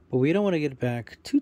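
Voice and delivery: ordinary delivery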